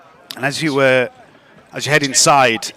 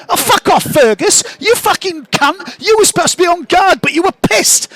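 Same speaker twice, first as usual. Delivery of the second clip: very high, loud little creature; squeaky mushroom's indignant retort; arguing mushroom voices; 4 dB louder than usual. Acted. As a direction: argue back in a tiny, sweary voice. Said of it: "the voices of the other mushrooms".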